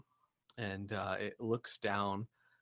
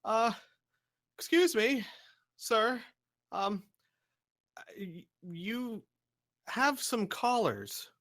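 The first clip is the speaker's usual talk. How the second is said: high, raised voice, hesitant and polite; polite, higher-pitched booming call; booming but polite; 11 semitones higher, 3 dB louder, slower than usual. Acted. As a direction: call out courteously to someone far off. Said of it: "his voice is very booming".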